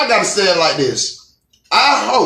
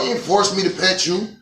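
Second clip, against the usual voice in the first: normal talking voice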